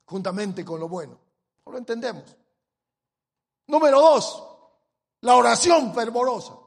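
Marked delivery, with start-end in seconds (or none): 3.7-6.6 full-force preaching. Acted. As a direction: hammer the theme home from the pulpit. Preacher proclaims points.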